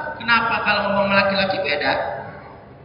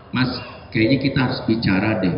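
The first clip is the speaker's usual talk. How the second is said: much lower, hushed ominous line; serious wife; 11 semitones lower, 4 dB quieter than usual. Retold or imitated. Wife telling husband they need to talk, low and hushed. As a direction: say it low and foreboding. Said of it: "Kalimat yang paling gak suka".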